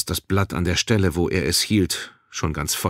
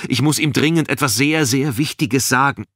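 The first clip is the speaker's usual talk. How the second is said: higher, louder pleading; urgent insistence; 7 semitones higher, 4 dB louder than usual. A narrator's reading. Balin needs his superior, urgent insistence. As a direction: press the point with urgency. Pressing guard to let him through.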